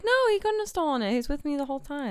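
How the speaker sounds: mock defending. Rose defending Jack to the family, high and fast. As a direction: rattle it off, defending him. What Rose should have said.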